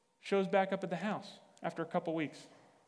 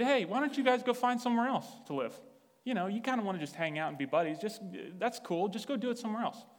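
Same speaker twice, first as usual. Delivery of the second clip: breezy dismissal; 6 semitones higher than usual. Retold or imitated. Breezily telling housemates to move out.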